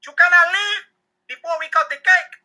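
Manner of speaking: high-pitched, loud put-on host voice; exclaiming host in a put-on voice; urgent party host. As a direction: stop a departing guest, loud and high. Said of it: "The host of the party runs to me and says".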